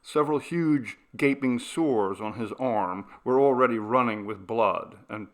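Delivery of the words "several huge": ordinary delivery, nothing marked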